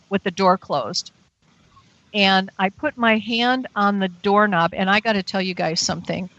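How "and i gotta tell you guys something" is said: ordinary delivery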